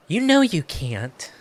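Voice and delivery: ordinary delivery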